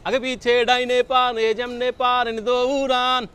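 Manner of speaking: drawn-out dramatic warning; slow warning call. Pretend. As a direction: stretch out every word of the warning. Mock warning to run away.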